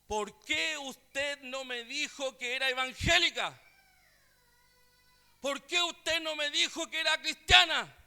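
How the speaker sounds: retold fury, pitch climbing; witch's angry outburst. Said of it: "Y se enojó la mujer".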